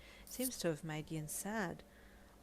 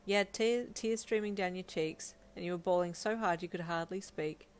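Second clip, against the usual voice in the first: ordinary delivery, nothing marked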